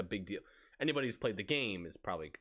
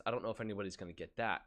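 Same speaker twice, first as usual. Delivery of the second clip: ordinary talk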